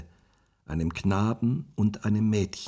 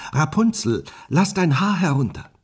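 higher, louder call with falling close; 8 semitones higher, 6 dB louder than usual. Acted. The prince calls Rapunzel to let down her hair, falling close. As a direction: summon her firmly, ending down. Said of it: "kam und rief".

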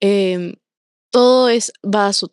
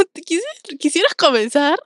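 real giggle through a higher-pitched question; laughing; 11 semitones higher than usual. Real, not acted. Podcast host handing over to Laura, laughing. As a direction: ask it high, still giggling.

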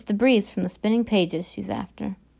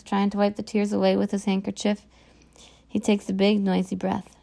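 usual speaking voice

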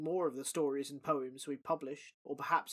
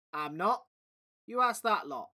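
louder, mocking Cockney pigeon; cheeky pigeon; 3 semitones higher, 4 dB louder than usual. Pigeon insisting to Dom it cannot talk, cheeky. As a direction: insist with a wink.

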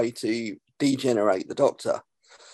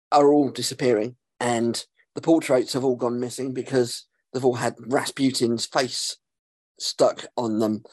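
normal talking voice

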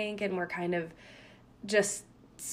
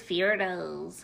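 low DJ voice. Shown as a demonstration. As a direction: drop into the DJ voice. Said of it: "Hi, Feardos".

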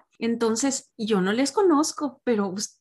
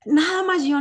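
mock martyr; 4 semitones higher, 5 dB louder than usual.

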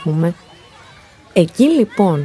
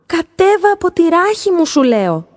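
shouting; 12 semitones higher than usual. A narrator's reading. Bewildered dolphin shouts at the star to get off. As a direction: shout it, fed up and confused. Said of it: "απάντησε φωνάζοντας".

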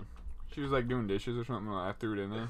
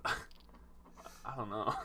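laughing; 14 dB quieter, much slower than usual. Real, not acted. Laughing too hard to speak.

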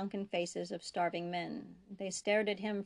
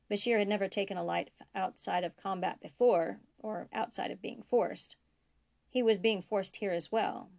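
ordinary delivery, nothing marked

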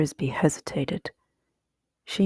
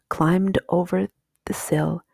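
ordinary delivery

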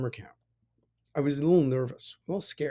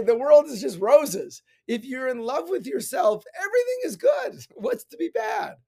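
high giddy delight; 9 semitones higher than usual. Acted. Gushing about loving yourself.

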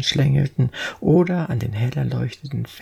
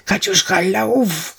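high, raised warning cry; shrieking; 6 semitones higher, 4 dB louder than usual. A narrator's reading. Boy screams at his friend to flee the policeman, shrieking. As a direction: shriek an urgent alarm. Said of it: "kreischte Mischka auf".

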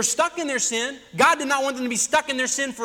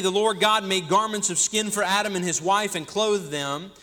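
normal talking voice

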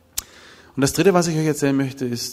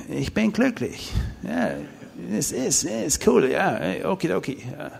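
happy biker voice; 6 semitones higher, 3 dB quieter than usual. Retold or imitated. Former Hells Angel sending a happy voice message, beaming. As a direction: play the freed man grinning into a voice note. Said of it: "„Hey“, sagte".

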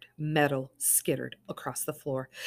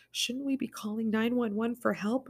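put-on anxious girl asking; 7 semitones higher than usual. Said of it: "Early asked".

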